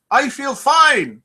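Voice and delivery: hammed-up pirate that misses the accent; a pirate in a joke, voiced half-heartedly high; failed pirate accent. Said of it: "my pirate accent".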